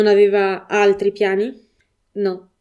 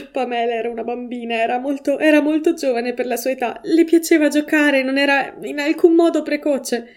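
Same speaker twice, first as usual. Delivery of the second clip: tearful mother; 5 semitones higher than usual. A narrator's reading. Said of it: "disse fra i singhiozzi".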